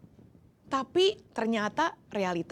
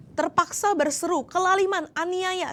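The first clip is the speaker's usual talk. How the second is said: Terpaksa berseru called out louder and higher; raised voice; 8 semitones higher, 4 dB louder than usual. Shown as a demonstration. Preacher echoing Jeremiah's forced cry, louder and higher. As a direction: lift Terpaksa into a raised call. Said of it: "terpaksa aku berteriak".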